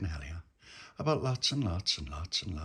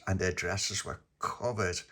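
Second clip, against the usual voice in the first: plain reading voice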